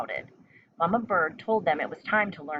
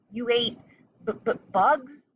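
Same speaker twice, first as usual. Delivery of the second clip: high stammering disbelief; shocked stutter; 5 semitones higher, 4 dB louder than usual. A narrator's reading. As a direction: stumble over bugs in shock. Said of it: "stuttered one".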